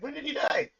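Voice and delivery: high-pitched, baffled question; mock incredulous mother. Mother questioning why the painter still cries, mock incredulous. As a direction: jump up in pitch, imitating her disbelief.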